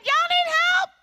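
high, loud breezy offer; casual teenager calling over; teen girl voice. Retold or imitated. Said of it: "she said".

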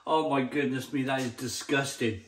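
ordinary talk

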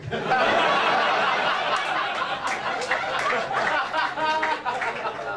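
long loud laugh at his wife's line; big real laugh. Real, not acted. Comic laughing at his wife's joke, loud laugh.

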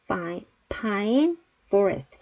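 pine with a popped P, forest with lip-teeth F; slow pronunciation demo; careful P and F. Shown as a demonstration. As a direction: pronounce P and F cleanly. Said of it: "P 발음과 F 사운드가 꼬이지 않게".

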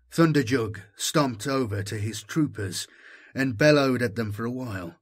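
ordinary delivery

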